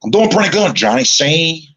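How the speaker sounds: high-pitched, put-on funny voice; practiced character voice. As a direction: answer in a silly high character voice. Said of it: "practicing this. And I finally got it".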